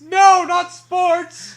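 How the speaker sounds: high, loud, slow mock dismay; mock horror. Cohost groans at the blurb in mock horror. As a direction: wail it in fake despair.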